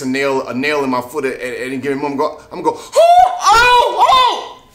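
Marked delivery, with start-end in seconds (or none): 2.8-4.7 loud, high falsetto yelp with a laugh; high pained yelp. Retold or imitated. Coach mimicking a nail in his foot, yelping. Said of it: "my voice goes up high".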